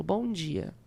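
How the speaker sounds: BOM DIA said low, soft and even; neutral falling greeting. Shown as a demonstration. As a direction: say "Bom dia" quietly with a plain falling close. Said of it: "posso falar assim, por exemplo".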